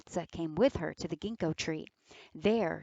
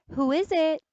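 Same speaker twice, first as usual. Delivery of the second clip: put-on child's voice; 9 semitones higher, 7 dB louder than usual. Eldest girl asks through door.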